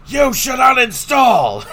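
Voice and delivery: slow, high mocking yell; raging gamer. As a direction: bellow it like a sore loser.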